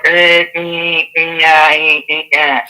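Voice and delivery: choppy eh eh like a broken line; robotic stutter. Retold or imitated. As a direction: imitate a cutting-out phone. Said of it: "you're sounding like a robot".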